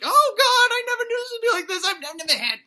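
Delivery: put-on loud, very high squeal of a horrified boy; frantic falsetto kid voice; panicked kid. Boy trapped in the video game cries out, panicked. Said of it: "that's what the kid sounds like".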